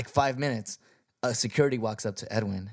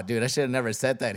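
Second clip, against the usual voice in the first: normal talking voice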